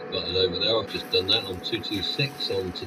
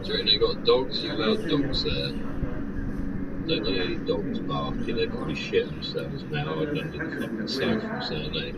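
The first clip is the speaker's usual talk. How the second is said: whispering; 10 semitones higher than usual. Real, not acted. Whispered aside about dogs.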